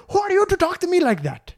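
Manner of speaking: put-on very high, affronted Indian-accented voice; high-pitched mock indignation; outraged man imitation. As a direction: turn back to demand respect, hammed up. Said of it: "raising his voice a lot".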